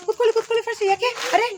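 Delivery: high, loud urgent questioning; urgent, quick. Shown as a demonstration. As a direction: fire off worried questions. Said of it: "ಇನ್ನೂ ಸ್ವಲ್ಪ fast ಇರ್ಬೇಕು".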